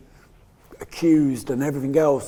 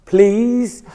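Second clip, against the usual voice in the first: pleading please; 7 semitones higher, 8 dB louder than usual. Retold or imitated. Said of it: "And I was like".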